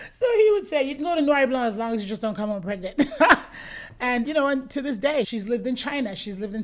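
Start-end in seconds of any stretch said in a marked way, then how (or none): 0.1-3.0 real laughter spilling into the quote, louder; can't stop laughing while telling it; laughing while retelling the husband's joke; laughing through it. Real, not acted. 3.1-4.0 real burst of high laughter; a hearty laugh at the punchline; bright laughing burst; loud laugh. Real, not acted. Laughs at her own story.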